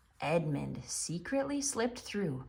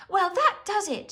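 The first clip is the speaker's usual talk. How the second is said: high, loud angry outburst; fed-up, fierce declaration; furious cry; 12 semitones higher, 4 dB louder than usual. A narrator's reading. Lucy furious at Tumnus's arrest, angry cry. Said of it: "she cried".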